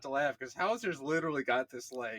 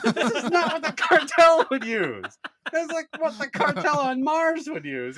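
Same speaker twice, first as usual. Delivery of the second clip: higher, exasperated disbelief; teasing scorn, pitched up; exasperated scolding; 10 semitones higher, 6 dB louder than usual. Retold or imitated. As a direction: berate him for the silly gun. Scolding Hauser about the gun.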